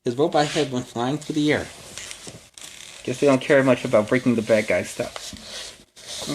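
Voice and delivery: plain reading voice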